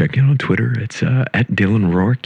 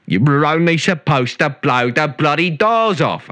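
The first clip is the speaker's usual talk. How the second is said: Cockney film quote; 7 semitones higher, slower than usual. Pretend. Michael Caine quote.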